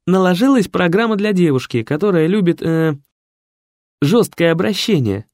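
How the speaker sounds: flustered assistant explaining; explaining secretary. A narrator's reading. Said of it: "объяснил секретарь".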